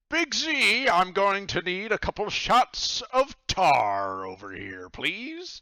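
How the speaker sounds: high, loud, slow shout across the bar; calling out. Acted. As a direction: call to the bartender over the room. He kinda calls out.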